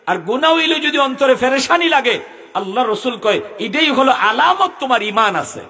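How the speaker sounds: high, forceful sermon delivery; ringing exhortation to the congregation; impassioned preaching. Real, not acted.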